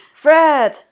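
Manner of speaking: loud, high call of a name. Acted. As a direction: call his nephew through the door. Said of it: "rief Scrooge".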